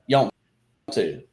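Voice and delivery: "yumped to" blended from "do you want to" into yump-tuh; run-together Southern contraction. Shown as a demonstration. Showing a friend how Southerners say it, "yumped to" slurred together. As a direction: fold "yumped to" into one slurred word. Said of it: "a combination of the three words".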